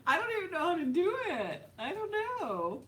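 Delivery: helpless high-pitched whine through laughter; laughing protest. Real, not acted. Embarrassed streamer protesting she can't do accents, laughing protest.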